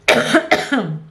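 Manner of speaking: quick dry cough. Real, not acted. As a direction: cough out a throat tickle. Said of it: "I have a tickle in my throat".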